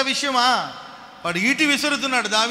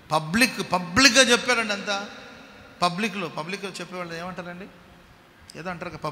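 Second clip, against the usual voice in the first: ordinary talk, nothing marked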